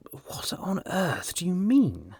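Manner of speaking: high, slow, incredulous; astonished exclaim. A narrator's reading. Hughie stunned by news of the baron, exclaiming. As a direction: gasp it out in disbelief. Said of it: "exclaimed Hughie".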